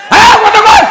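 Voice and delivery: very loud, high-pitched yell; yelled demand. Real, not acted. Preacher demanding the audience respond, full yell. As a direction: yell at the crowd to speak up.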